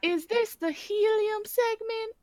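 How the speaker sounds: squeaky chipmunk-high voice; slow, high helium talk. Pretend. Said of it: "Are we all on helium now?".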